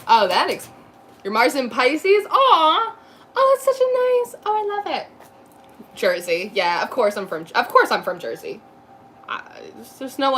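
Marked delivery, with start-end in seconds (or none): 2.3-5.1 much higher, gushing aww; delighted coo. Real, not acted. Reacting to a viewer's Mars in Pisces with a delighted coo. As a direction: gush over it like it's adorable.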